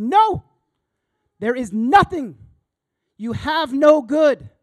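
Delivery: high, loud shout of no; slow, thunder it out; shouted verdict. Acted. Preacher shouts the law's verdict at the self, full force.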